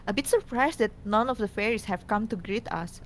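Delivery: usual speaking voice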